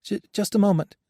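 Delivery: high, flustered stammer; nervous stammer. A narrator's reading. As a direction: stumble over the first word in panic. Joshua panicking, stammering.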